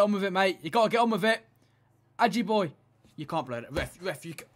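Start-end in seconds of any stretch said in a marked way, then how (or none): none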